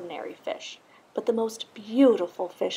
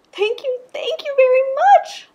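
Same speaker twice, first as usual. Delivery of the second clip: very high, loud playful squeal; bubbly thanks; 11 dB louder than usual. A narrator's reading. Little blue fish thanking Rainbow Fish, very high playful squeal. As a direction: burst out with delight. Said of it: "bubbled playfully".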